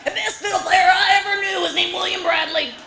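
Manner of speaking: put-on raspy old heckler. Uncle loudly holding forth like Waldorf. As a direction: declare it like Waldorf in the balcony. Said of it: "Waldorf sitting here".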